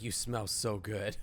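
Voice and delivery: lightly voiced creep; mock creepy line. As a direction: imitate the creepy compliment, drawn out. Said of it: "He's like".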